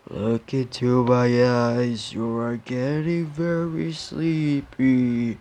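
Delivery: slow, drawn-out hypnotist voice. Acted. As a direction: lull them slowly like a stage hypnotist. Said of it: "that was just a hypnotist impression".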